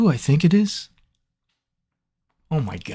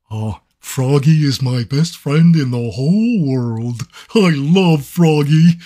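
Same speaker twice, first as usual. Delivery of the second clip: slow, drawn-out goofy character voice; 3 dB louder, slower than usual. Acted. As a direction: stretch every word like a dopey cartoon. Voice actor doing character voice.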